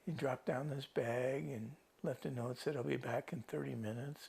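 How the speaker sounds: quiet, halting, holding back emotion; soft voice with long pauses, moved by the memory; getting emotional. Real, not acted. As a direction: recount it softly, stopping to collect yourself. Recalls rescue, chokes up emotionally.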